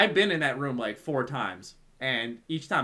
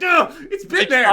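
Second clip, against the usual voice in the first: disappointed realization; 14 semitones higher, 8 dB louder than usual. Retold or imitated. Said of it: "I'm like".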